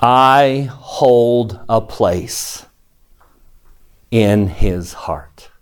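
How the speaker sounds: very slow, every word drawn out and weighted; deliberate, spaced delivery with a falling close; slow, emphatic repetition. Real, not acted. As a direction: drive the line home word by word. Teacher repeats the hymn line.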